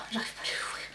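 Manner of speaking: muttered under the breath; whispering. Real, not acted. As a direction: whisper to yourself while fiddling. Struggling to open the product.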